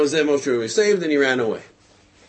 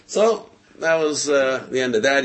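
ordinary talk

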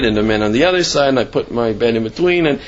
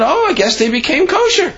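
naive high voice. Retold or imitated. Retelling his naive assumption.

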